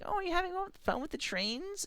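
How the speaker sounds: put-on high, soft sing-song to a child; cooing visitor. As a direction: imitate a sugary adult greeting a toddler. Imitates intruding adult cooing.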